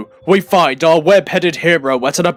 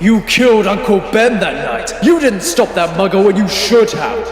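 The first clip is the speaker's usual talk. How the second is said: lightly raised accusing voice; a hint of cruel taunting; accusing double; 6 semitones higher, 4 dB louder than usual. Peter's double blaming him for the deaths.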